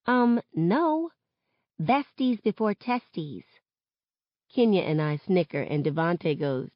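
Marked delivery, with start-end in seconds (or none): 0.1-3.4 high, drawn-out correction; sassy rebuff. Acted. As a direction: put the boyfriend in his place, slow and pointed. Sassy rebuff to friend's boyfriend.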